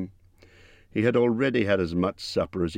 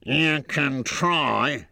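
troll voice; 8 semitones higher, much slower than usual. A narrator's reading. Troll Bert answers.

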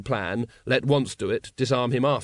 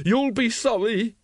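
high, slow, venomous threat; hissed threat; 10 semitones higher, 3 dB louder, slower than usual. A narrator's reading. Cornered secretary threatens the watchmen, hissing. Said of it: "he hissed".

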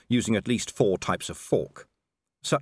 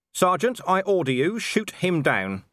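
high, forceful start, then very slow; stern command; 9 semitones higher, 4 dB louder than usual. Acted. Commander ordering his sergeant to shoot the captain, stern. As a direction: give the order with slow, deliberate weight.

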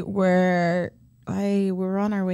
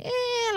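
high mock wail; imitated crying; 5 dB quieter than usual. Tired little girl told to sit down, imitated crying. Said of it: "like, she was crying".

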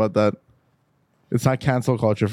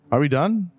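ordinary talk, nothing marked

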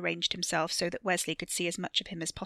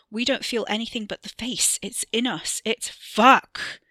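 higher, building to a loud curse; agitated outburst; 4 semitones higher, 7 dB louder than usual. A narrator's reading. Theo struggles to explain to Wesley, agitated and louder. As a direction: blurt it out, ending in a loud swear. Theo blurting to hold attention.

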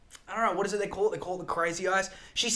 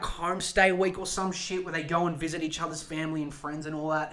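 normal talking voice

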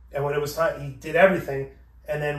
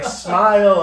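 loud mock command; 5 semitones higher, 11 dB louder than usual. Clerk voiced loud.

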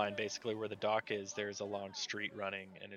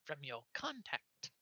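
high, halting gnome voice; squeaky gnome messenger; 5 semitones higher, 7 dB quieter, slower than usual. Acted. Gnome passing on a contact's message, high and halting. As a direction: pipe it up carefully as a little gnome.

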